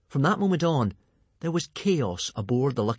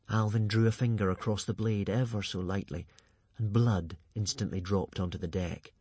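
ordinary delivery

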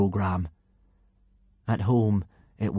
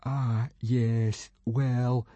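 small, hesitant, sheepish misery; glum, halting reluctance; miserable; 2 semitones higher, 4 dB quieter, much slower than usual. A narrator's reading. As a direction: stall miserably before confessing. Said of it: "said Fishlegs miserably".

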